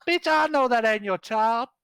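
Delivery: high, loud sassy ranting woman; sassy woman npc. Acted. GM voicing the dancer, ranting to the players in a high brassy voice. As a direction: rant about goblins in a shrill high voice. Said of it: "she's like".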